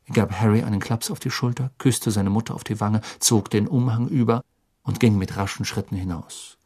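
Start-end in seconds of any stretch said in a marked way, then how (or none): none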